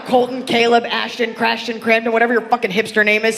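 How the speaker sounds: ordinary delivery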